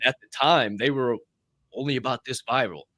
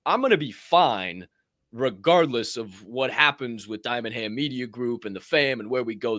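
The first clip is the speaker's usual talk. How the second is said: ordinary delivery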